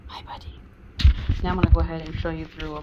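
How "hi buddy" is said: soft whispered greeting; whispering. Real, not acted. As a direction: hush it to the dog. Greeting dog softly.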